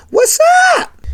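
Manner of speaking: what's up sung far up high, stretched out; drawn-out what's up. Acted. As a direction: toss a high, lilting what's up to the caller. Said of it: "I like that".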